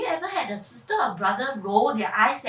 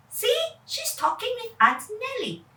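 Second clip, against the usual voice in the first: put-on high, teasing boy voice; cheeky boy; 10 semitones higher than usual. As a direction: coax her like a cheeky kid. Kevin teases about mom.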